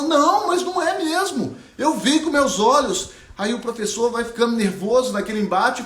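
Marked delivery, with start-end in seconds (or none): 0.0-3.1 put-on higher, earnest girl's voice pleading her case; a girl who saw colour, insisting; young Ariana insisting. Ariana insists to her teacher, higher and earnest.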